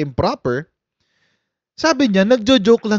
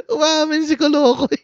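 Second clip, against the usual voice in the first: gleeful; 9 semitones higher than usual. Real, not acted. Gleeful mock.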